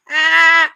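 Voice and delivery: high burst of real laughter; loud laugh. Real, not acted. Wife laughing out loud at her own Valentine's card.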